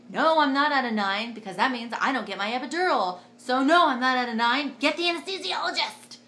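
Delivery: loud, insistent retold outburst; mock outraged refusal. Woman in labor refusing nurse's verdict, loud mock outrage. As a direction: build to a high, loud demand.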